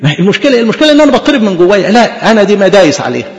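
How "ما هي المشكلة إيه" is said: normal talking voice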